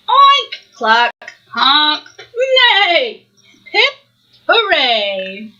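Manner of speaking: a string of farm noises, jumping up and down in pitch; animal call medley. Acted. Reading to a child, rattling off each farm noise. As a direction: make every animal sound in a row. Reader performs all animal calls.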